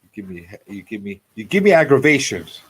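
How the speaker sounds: normal talking voice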